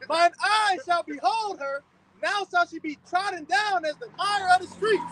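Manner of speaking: slow, solemn Bible reading; scripture reading. Acted. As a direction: read it like a preacher from the pulpit.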